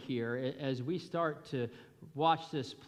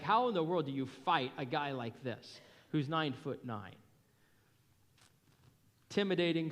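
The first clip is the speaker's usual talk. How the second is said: ordinary talk, nothing marked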